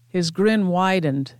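ordinary delivery

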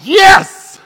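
high, loud burst of glee; gleeful shout. Acted. Pastor cheering that the Kenya trip is off, loud and high. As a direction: yell it like a kid let out of school.

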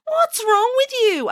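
high, slow sneering duckling; mocking duckling. A narrator's reading. Duckling sibling mocks the ugly one, high and slow. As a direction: look down on her with a high sneer. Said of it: "asked one duckling".

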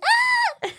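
very high mimicked shriek, not too loud; imitated startled scream. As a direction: squeal like she did when spooked. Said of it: "She really yelled, like, really loud".

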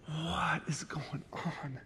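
slow, breathless whisper; stunned whisper. Retold or imitated. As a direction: whisper it in disbelief, drawn out. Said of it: "And I was like".

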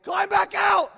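shouting high and loud in panic; frantic scream to climb back out; panicked yell. Retold or imitated. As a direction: yell to get out of the cave now. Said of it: "maybe a little bit more under control".

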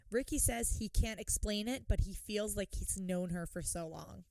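ordinary talk

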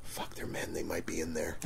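hushed, voiceless aside; furtive whisper. Retold or imitated. Vendor outside the men's room, furtively whispering. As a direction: whisper the worry to yourself. Retold nervous thought at bathroom.

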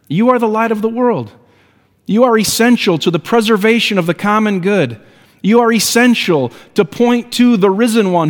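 high, ringing pulpit exhortation, rising to a peak; rally the congregation to shine; preaching at full force. Acted. Pastor urging believers to shine for God, in full preaching voice.

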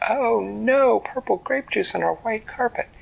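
high, loud, dismayed mommy gasp; mom's dismay. Acted. Mom sees grape juice stain.

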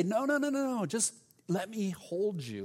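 acted plea, insisting on an embrace; tender insistence. Acting God insisting on embrace.

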